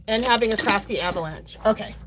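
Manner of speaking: normal talking voice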